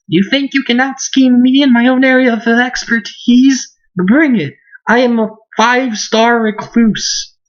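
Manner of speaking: high-pitched, hammed-up challenge; defiant recluse girl. Bernadetta dares them to out-scheme her, defiant high voice. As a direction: throw down the gauntlet in a high girl's voice.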